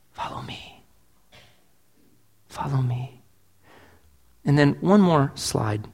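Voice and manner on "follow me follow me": hushed and tender call; quiet, intimate Follow me; soft whisper. Retold or imitated. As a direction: whisper it like a gentle invitation. Jesus quoted softly.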